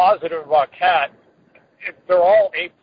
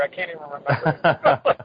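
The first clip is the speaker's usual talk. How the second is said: laughing; slower than usual. Real, not acted. Joking about the podcast game.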